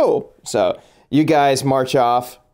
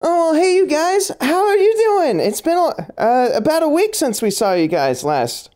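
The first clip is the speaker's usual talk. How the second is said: Kathy voice. Acted. GM voices Kathy greeting party.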